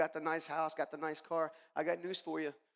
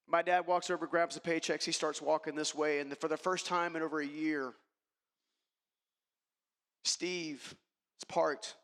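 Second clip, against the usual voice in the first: normal talking voice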